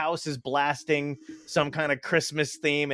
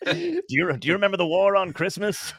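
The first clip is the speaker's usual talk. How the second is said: lightly voiced veteran, pitched up; mock war veteran; 7 semitones higher, 4 dB louder than usual. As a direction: reminisce like a mock war survivor.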